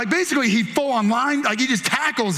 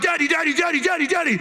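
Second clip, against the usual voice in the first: high, rapid-fire nagging; pestering child; 6 semitones higher, faster than usual. Acted. Little boy interrupting dad on the phone, pestering. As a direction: repeat it insistently in a raised kid voice. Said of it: "You don't just get to come and be like".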